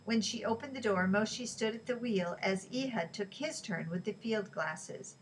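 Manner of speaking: ordinary delivery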